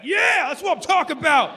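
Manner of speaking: high, loud put-on cheer; hammed-up crowd yell; mock cheering fan. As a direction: whoop like a relieved fan. Voicing cheering viewers.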